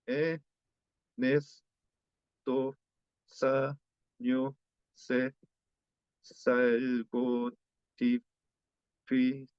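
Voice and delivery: slow, broken with pauses between words; halting learner reading; syllable by syllable. Shown as a demonstration. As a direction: stretch each word apart like a learner. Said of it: "vocal cerrada se convierte".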